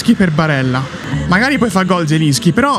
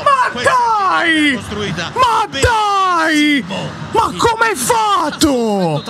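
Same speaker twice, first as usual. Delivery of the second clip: frustrated shouting; 10 semitones higher, much slower than usual. Real, not acted. Missed chance during live match.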